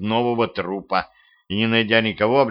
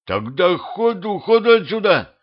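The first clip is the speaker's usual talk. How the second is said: panicked hurry; 9 semitones higher than usual. A narrator's reading. Petrovich fleeing before Matilda wakes, panicked. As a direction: build into a frightened scramble. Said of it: "заторопился Петрович".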